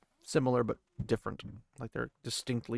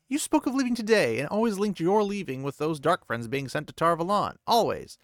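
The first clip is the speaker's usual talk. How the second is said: higher and louder, sharp and accusing; angry accusation; 8 semitones higher, 5 dB louder than usual. A narrator's reading. Lan confronting Nynaeve about her leaving, angry. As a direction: lay out the charge, barely holding back.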